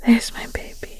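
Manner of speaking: gentle caring whisper to a sick partner; tender bedside whisper, unhurried. Acted. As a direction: whisper close and soothing. Caring ASMR whisper roleplay.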